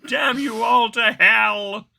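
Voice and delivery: very high, very loud, slow bellowing despair; Charlton Heston. Retold or imitated. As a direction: rage at the sky like a movie hero. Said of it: "Charlton Heston".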